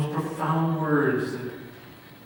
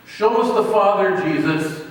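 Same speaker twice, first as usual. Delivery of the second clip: loud, higher-pitched demand; insistent disciple; 5 semitones higher, 7 dB louder than usual. Acted. Disciple Philip demanding of Jesus, insistent. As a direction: press the request loudly, as a hint of an impatient disciple.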